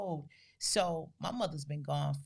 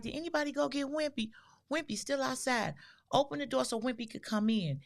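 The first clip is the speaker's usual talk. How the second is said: voicing her mother; 7 semitones higher, faster than usual. Daughter imitating her confused mother, higher and quick. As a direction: ask after the long-gone dog, worried and high.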